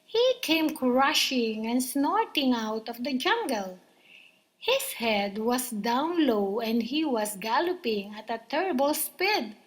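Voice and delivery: ordinary delivery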